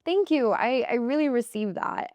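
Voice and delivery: high, mock gracious thanks. Her new self accepting praise, high gracious voice. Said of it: "somebody who was like".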